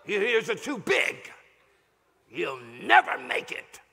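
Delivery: slow, drawn-out taunting voice; a jeering critic in the pastor's retelling; mocking insults. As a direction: sneer the insults out slowly.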